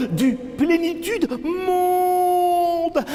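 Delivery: high, slow drawn-out cry; theatrical wailing chant, very high; wailed refrain. Acted. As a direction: soar up and hold the last word. Refrain cried out in poem.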